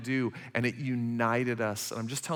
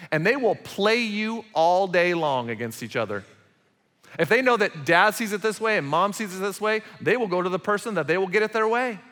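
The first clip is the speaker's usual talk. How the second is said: louder, higher, driving sermon voice; forceful preaching; 6 semitones higher, 4 dB louder than usual. Acted. Pastor warning parents in church, forceful preaching. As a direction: preach it out to the back row.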